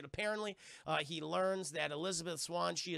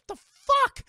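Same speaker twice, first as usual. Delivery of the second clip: much higher, much louder disbelief; shocked yell; 14 dB louder than usual. Retold or imitated. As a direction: shriek at a dead man walking. Shocked at revived pirate.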